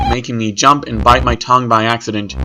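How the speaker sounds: plain reading voice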